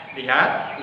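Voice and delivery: ordinary delivery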